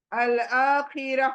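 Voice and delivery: ordinary talk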